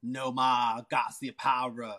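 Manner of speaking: put-on a mild Boston accent, slow; Boston impression. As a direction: drop the r in "car" like a Bostonian, slowly. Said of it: "hear my Boston impression".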